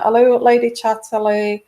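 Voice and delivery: normal talking voice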